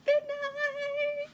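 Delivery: shrieked high and loud with delight; excited shout. Real, not acted. Vlogger cheering to camera as bid night starts, excited shout. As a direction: burst out cheering at the top of her voice.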